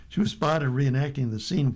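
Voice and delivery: usual speaking voice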